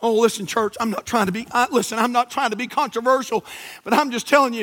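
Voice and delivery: ordinary delivery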